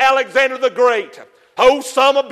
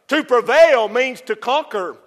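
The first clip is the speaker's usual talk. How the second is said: usual speaking voice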